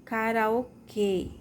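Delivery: "karaokê" slowly, with a closed final ê; "karaokê" drawn out, ending on a tight ê; closed vowel. Shown as a demonstration. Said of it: "O som é fechado".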